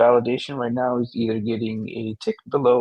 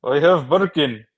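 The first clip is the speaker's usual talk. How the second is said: higher-pitched mock Russian; Russian accent; 5 semitones higher, 6 dB louder than usual. Bragging about a handbag, mock Russian accent. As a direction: insist in a put-on Russian accent. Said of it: "Oh, I get to be Russian".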